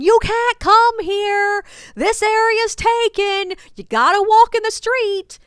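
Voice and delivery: high, loud mock screech with stretched words; screaming. Woman blocking the sidewalk for a selfie, screaming at a tour group.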